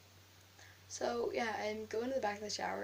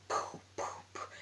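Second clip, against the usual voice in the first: puffed, voiceless mock punch sounds; punch noises; slower than usual. Girl re-enacting punching her classmate, breathy punch noises. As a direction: puff out each blow with breath only. Imitates the punches she threw.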